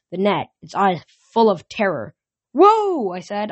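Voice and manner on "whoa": high, loud whoa; startled shout. Acted. Hero calling out to the thrashing calf, loud. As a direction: call out to stop the panic.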